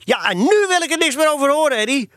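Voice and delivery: high, sharp motherly reprimand; exasperated mother laying down the law; stern. A narrator's reading. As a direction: cut him off strictly. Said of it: "zei mevrouw Loman streng".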